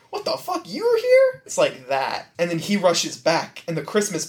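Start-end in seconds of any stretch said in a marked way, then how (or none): 0.1-1.4 high startled squeak; mock shock. Dwight startled by Angela behind him, high squeak. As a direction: jump at the surprise. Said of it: "he turns around and he's like".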